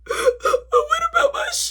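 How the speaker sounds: very high, slow wailing question; crying wail. Retold or imitated. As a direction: wail the question through tears. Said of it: "I'm still crying about".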